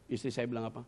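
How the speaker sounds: ordinary talk, nothing marked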